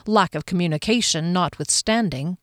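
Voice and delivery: ordinary delivery, nothing marked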